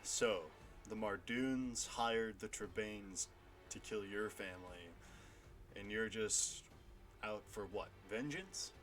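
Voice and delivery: menacing warhammer man. Acted. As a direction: lean in and sneer the question softly. GM voices warhammer figure.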